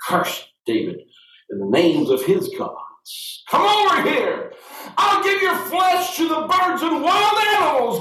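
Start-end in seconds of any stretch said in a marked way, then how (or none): 3.5-8.0 Goliath yelling. A narrator's reading. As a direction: bellow the threat across the valley. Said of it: "Goliath yelled".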